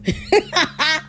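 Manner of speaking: short burst of laughter; amused laugh. Real, not acted. Finding the scripture funny, amused laugh.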